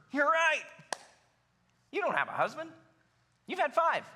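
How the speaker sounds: acting out pleased agreement; excited affirmation. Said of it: "Jesus is excited".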